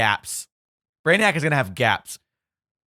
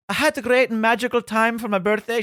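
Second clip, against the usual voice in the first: mock Irish lilt; 10 semitones higher than usual. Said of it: "She sounds like a leprechaun".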